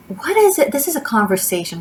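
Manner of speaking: high, loud annoyed cry; exasperated outburst. Real, not acted. Fed up with the motorcycle noise, a sudden high annoyed cry. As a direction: snap it out over the noise.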